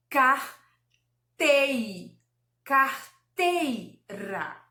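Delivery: "Carteira" very slowly, as car, tei, ra; long gaps between the syllables of "Carteira". Shown as a demonstration. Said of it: "que eu fale bem devagarzinho". ("Carteira" sílaba por sílaba, bem devagar)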